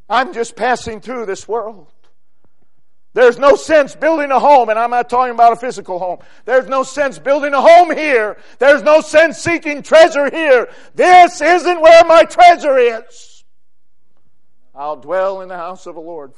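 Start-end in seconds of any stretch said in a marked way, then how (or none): 3.1-13.4 loud, raised, insistent preaching; full-force preaching. Real, not acted. Preacher warning against earthly treasure, full-force preaching. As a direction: hammer the repeated phrases, growing higher.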